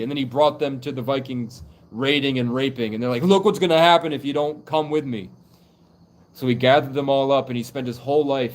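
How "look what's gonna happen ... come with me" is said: raised, forceful voice; loud urgent warning. Retold or imitated. Ancestor showing kids the Viking raids, warning loudly. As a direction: warn them loudly and insistently.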